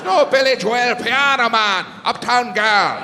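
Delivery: exasperated Irish fan; Irish exasperation. Retold or imitated. Irish sound guy listing songs at comic, exasperated Irish. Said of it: "He goes".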